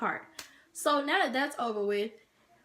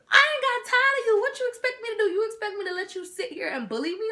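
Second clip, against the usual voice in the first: loud, high-pitched indignant protest; hammed-up fed-up rant; acted-out outburst; 12 semitones higher, 5 dB louder than usual. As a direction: blow up at a bully, voice way up high. Acting out her outburst at rival.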